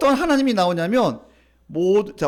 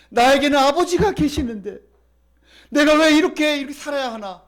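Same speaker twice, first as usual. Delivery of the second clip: raised, louder lament of a wayward son; acted anguished cry; 5 semitones higher, 5 dB louder than usual. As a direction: cry it out, building in force. Prodigal son's lament builds. (목소리 높여 터뜨리는 탄식)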